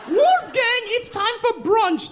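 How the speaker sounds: sing-song call of an upscale mom; posh mom. Retold or imitated. Mother calling her son to brunch, high prim call. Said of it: "That's what the woman said".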